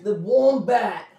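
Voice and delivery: stretched-out mock Aussie, high and loud; Australian accent. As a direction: chime in with a hammed-up Australian drawl. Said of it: "they make Kano really fucking Australian".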